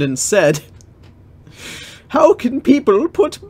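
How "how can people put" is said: much higher, louder mock mother; mother's high voice. Legolas' mother scolding the woods, high and loud. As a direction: squeal it like an outraged mother.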